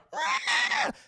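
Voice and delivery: piercing high squawk of a pterodactyl; shrill mimicked screech; mock screech. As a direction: shriek like a creature overhead.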